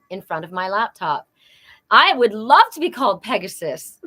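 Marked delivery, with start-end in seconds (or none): 1.9-4.0 louder, eager and delighted; beaming about the nickname; excited. Real, not acted. Thrilled about the nickname Pegasus, an eager delighted voice.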